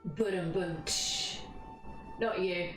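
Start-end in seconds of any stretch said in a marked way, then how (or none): 0.0-1.4 spoken rimshot with a long cymbal hiss; slow mouth-made joke drum roll; imitated drum sting. Streamer marking a joke in chat, imitating a rimshot.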